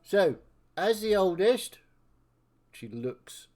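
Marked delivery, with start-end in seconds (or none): 0.0-1.8 loud, high, drawn-out elder's pronouncement; pompous elder taking charge; self-appointed elder. Acted. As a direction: announce it slowly as the senior one. Elder begins a decree.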